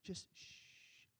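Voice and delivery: soft, whispered polite shushing; a quiet kindly shh; gentle hush. Acted. The crowd it was not, softly whispering shh. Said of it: "Not like".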